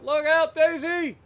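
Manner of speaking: high, loud yell; alarmed shout at the dog in the road. Real, not acted.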